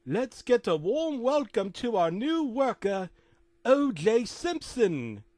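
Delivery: loud, high, drawn-out announcer voice; hammed-up high announcement; big announcer. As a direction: hail the newcomer like a show host, stretching every word. Announcing new worker to crowd.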